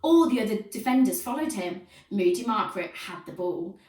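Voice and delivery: usual speaking voice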